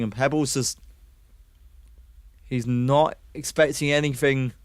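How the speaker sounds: fast, lively esports commentary; excited caster calling a round; play-by-play casting. Acted. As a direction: call the action as it happens. Casting the retake.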